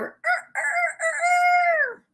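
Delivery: high squawked er-er-er-er; rooster crow. Acted. Storyteller giving another rooster call, high crow. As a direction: imitate a real rooster, way up high. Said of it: "A rooster crows".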